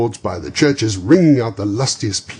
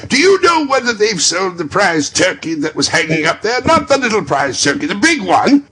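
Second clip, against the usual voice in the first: put-on eager call to a boy below; 12 semitones higher, 8 dB louder than usual. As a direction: shout down to the street. Calling to the boy.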